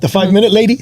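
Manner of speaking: ordinary talk, nothing marked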